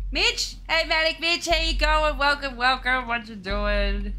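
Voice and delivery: high, loud, delighted welcome; excited greeting. Real, not acted. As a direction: shout hello as a friend turns up. Excited greeting to arriving viewer.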